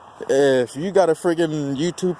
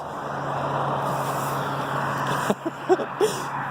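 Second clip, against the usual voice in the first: long airy rising laugh; breathy laugh; 7 dB quieter than usual. Real, not acted. Looking at the rubber on the road, a long airy laugh.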